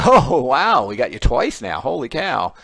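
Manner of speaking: loud, high mock delight; a loud, laughing wow from the front row; excited students. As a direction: crow like a class that caught the teacher out.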